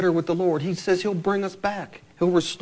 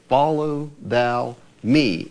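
very slow and a touch louder; slow drawn refrain; 4 semitones lower, 4 dB louder, much slower than usual. Acted. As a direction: stretch the words as a firm calling.

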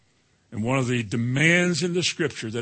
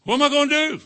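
high, loud mock-panicked wail; 11 semitones higher, 4 dB louder, faster than usual. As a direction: fret like a believer afraid to lose everything. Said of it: "voicing worried believer".

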